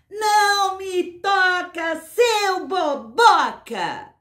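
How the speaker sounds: high, long-drawn chanting yell; slow, high storybook shout. Acted. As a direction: call it out so the whole group hears, stretching every word. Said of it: "comecei a gritar pra turma toda se ligar".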